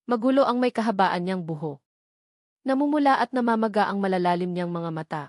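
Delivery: ordinary delivery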